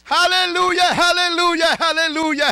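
high, loud shouted preaching; fiery sermon climax; preaching at full force. Acted. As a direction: cry it out to the congregation. Proclaiming the truth.

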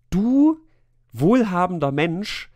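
normal talking voice

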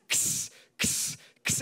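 axe chopping noise. Retold or imitated. Imitating axe chopping windshield.

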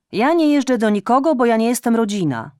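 high, loud reproachful tirade; scolding mother. Acted. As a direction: nag her like an aggrieved mother. Mother snapping refusal. (matka z pretensją podniesionym głosem)

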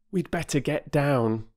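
a hint of a boy taking the lead; Peter, a boy. A narrator's reading. Boy suggesting to his sisters they climb down, lightly voiced. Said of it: "said Peter".

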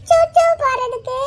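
put-on high, slow little-child question; hesitant small child. Child asking teacher the way to toilet, small voice. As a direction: ask it haltingly in a child's voice.

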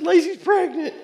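high, laughing blurt of the news; laughing disbelief. Retold or imitated. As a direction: blurt it out through a helpless laugh. Retelling shocked announcement to friend.

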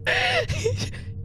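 high squealing laugh; high laughing fit. Real, not acted. Player cracks up at friend's bungle, squealing laugh.

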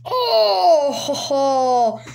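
high, loud burst of laughter; a real excited laugh at the big pull; overjoyed laugh. Real, not acted. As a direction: laugh out in disbelief at the card. Pulls out prized autograph card.

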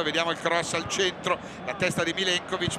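ordinary delivery

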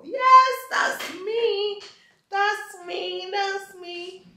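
high, slow, giddy yes that's me; excited. Real, not acted. Birthday girl presenting herself to viewers, high and thrilled.

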